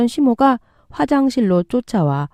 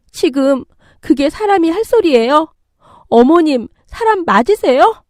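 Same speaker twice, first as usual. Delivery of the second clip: high-pitched indignant challenge; outraged; 9 semitones higher, 4 dB louder than usual. Acted. Daughter-in-law finally talking back to mother-in-law, outraged. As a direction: confront her, voice climbing in anger. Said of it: "참을 수 없는 분노가 치미는".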